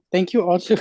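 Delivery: usual speaking voice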